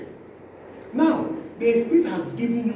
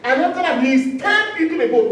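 preaching at force; 9 semitones higher, 6 dB louder than usual. Acted.

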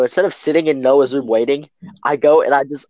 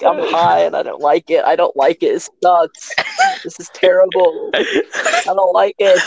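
higher, whiny wail that slows at the end; tearful complaint; 7 semitones higher than usual.

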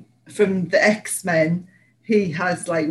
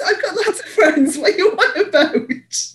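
laughing; 12 semitones higher, 3 dB louder than usual. Real, not acted. Teasing laughing disbelief.

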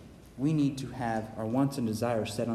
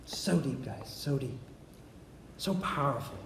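awed hush; 7 dB quieter than usual. Real, not acted. Awed reflection on verse.

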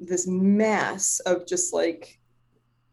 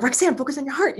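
higher, frantic self-scolding; maniacal self-talk; 7 semitones higher than usual. Retold or imitated. Meditator calling herself back to focus, maniacal. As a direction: retell pulling yourself back, manic. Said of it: "I was kinda going maniacal".

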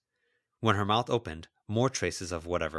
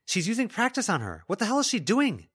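raised, alarmed voice; 13 semitones higher, 4 dB louder than usual. Acted. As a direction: shout out in alarm. Boy alarmed at the fight.

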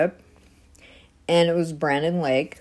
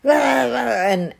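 normal talking voice

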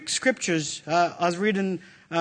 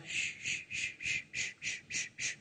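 soft whispered shushing; soothing shush; 17 dB quieter than usual. Shown as a demonstration. Mom settling her crying baby, soft shushing. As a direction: hush a baby to sleep. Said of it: "the mom would just settle".

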